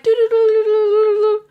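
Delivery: high, loud sing-song doo-doo; silly crab tune. Retold or imitated. Mimicking the crab's goofy little tune, high and loud. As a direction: play the crab like a cartoon.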